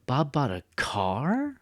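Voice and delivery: "car" stretched and swooping up; slow surprised question landing on "car"; exaggerated stress on CAR. Shown as a demonstration. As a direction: draw "car" out, high and questioning. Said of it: "I'm actually make it longer".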